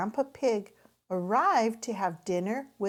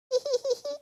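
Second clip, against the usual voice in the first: giggle. A narrator's reading. Characters laugh.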